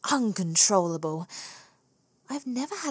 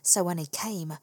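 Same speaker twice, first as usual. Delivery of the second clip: ordinary delivery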